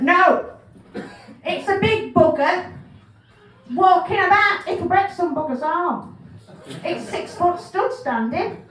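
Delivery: loud, high, insistent caller voice. Retold or imitated. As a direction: argue the swan is huge and dangerous. Caller insisting swan is huge.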